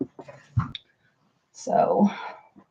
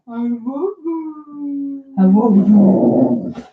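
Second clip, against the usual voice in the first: howling like a wolf pup; 4 semitones higher, 3 dB louder than usual. Shown as a demonstration. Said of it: "We're trying to get Moxie to talk for you".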